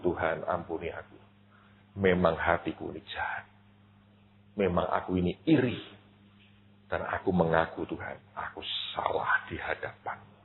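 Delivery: soft, low confession; contrite prayer. Retold or imitated. Pastor repenting before God, soft and low. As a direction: pray it humbly, almost breathed.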